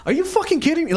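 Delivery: high-pitched incredulous outburst; indignant, pitched well up without yelling; acted outrage. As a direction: act out an angry guy confronting a scammer. Retelling the angry guy's confrontation.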